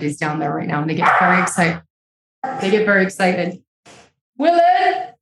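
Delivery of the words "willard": high, raised call across the house; calling a name. Real, not acted. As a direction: call him over the noise. Calls assistant over excited dogs.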